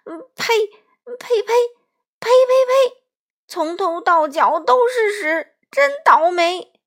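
a fat thief covered in dung, spitting and groaning; disgusted spitting. Acted. (满身是粪，越呸越响的嫌弃)

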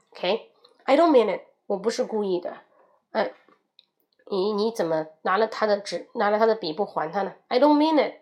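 normal talking voice

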